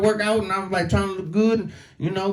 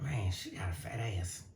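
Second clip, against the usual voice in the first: very soft private remark; hushed aside; 6 semitones lower, 18 dB quieter than usual. Retold or imitated. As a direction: murmur it under your breath. Aside thought.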